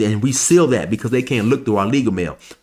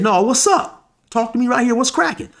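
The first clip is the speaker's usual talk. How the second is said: defiant challenge; 12 semitones higher than usual. Retold or imitated. Facing down the man who's challenging him.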